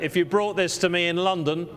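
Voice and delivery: normal talking voice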